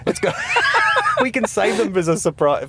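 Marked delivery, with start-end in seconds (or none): 0.4-1.2 squeaky giggle; high-pitched giggle. Real, not acted.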